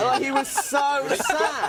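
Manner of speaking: can barely get it out for laughing. Real, not acted. Comic cracks up retelling the sad heckler, real laughter. Speaker cracking up mid-story.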